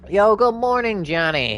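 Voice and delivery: high, loud happy hello; cheery greeting. Real, not acted. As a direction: call out good morning brightly.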